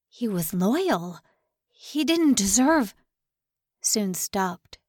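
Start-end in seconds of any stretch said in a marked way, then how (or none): 0.1-2.9 voice breaking. Acted. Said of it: "He doubted his voice".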